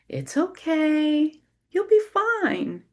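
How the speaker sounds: high, slow, soothing; sing-song reassurance; coaxing a toddler. A narrator's reading. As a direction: coax a little one down a slide. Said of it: "encourage a toddler to come down a slide".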